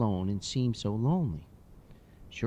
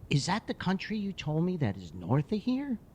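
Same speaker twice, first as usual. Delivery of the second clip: put-on curious little boy; 6 semitones higher than usual. Boy quizzing the hockey player about places, high and curious. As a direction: ask like a curious kid.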